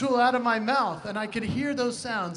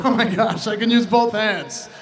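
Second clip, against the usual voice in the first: amazed dentist; 10 dB louder than usual. Retold or imitated. Dentist marvels at the boy's huge mouth, amazed.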